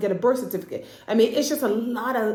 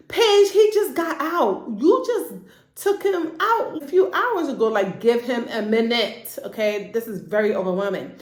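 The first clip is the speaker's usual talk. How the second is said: exasperated plea; 3 semitones higher than usual. Retold or imitated. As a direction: beg Paige to give him a break. Pleading with character on screen.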